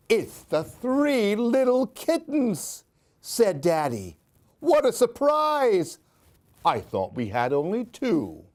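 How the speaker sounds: lively, sing-song storybook reading; animated picture-book read-aloud; reading to children. A narrator's reading. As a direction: read it like story time for little ones.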